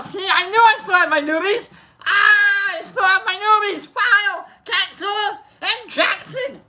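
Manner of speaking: high, gleeful outburst; excited. Real, not acted. Host discovers he still has his newbies, high and excited. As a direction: crow it way up high. Delighted to find newbies in chat.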